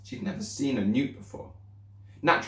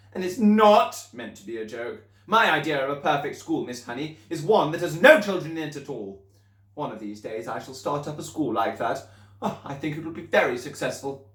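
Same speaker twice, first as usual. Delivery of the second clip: put-on loud, higher, booming headmistress sneering at children; Trunchbull voice; 5 semitones higher, 5 dB louder than usual. Headmistress sneering to the class about children.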